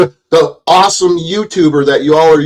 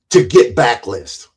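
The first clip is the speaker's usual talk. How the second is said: ordinary delivery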